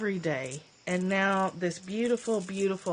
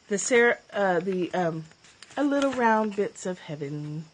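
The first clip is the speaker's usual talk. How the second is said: usual speaking voice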